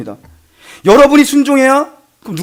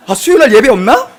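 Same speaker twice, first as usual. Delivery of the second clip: higher, louder mock wondering aloud; husband hinting; 6 semitones higher, 6 dB louder than usual. Waiting husband wondering about wife's church service, comically pointed. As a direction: play up the pointed question for laughs.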